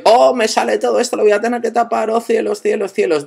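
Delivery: high, startled oh cielos over exposed data; dramatic fake panic at his data popping up; mock alarm. Real, not acted. His personal data appears on screen, high mock panic.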